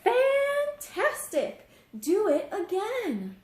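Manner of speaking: high, slow, loud cheering shout; delighted shout. Acted. Princess urging the tired dragon on, shouting with glee. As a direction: cheer him on at the top of your voice. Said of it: "Elizabeth shouted".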